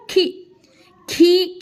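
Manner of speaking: खी said with a short, clipped ee, not drawn out; short ee sound. Shown as a demonstration. Teacher showing pupils the short ee in खी. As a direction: say खी quickly, vowel kept short. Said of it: "खींच के नहीं बोलना है".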